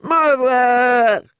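very high, loud wail. A narrator's reading. Cut king cries to his mother, high wail. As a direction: cry out like a hurt child. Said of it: "he wailed".

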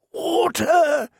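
high, thin gasp for water; gasped plea. Acted. Bearded prisoner gasps to the girl for water. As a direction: gasp it out, parched.

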